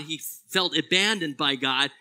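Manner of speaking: ordinary talk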